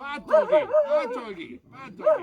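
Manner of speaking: loud, high scolding shout; scolding the dog. Real, not acted.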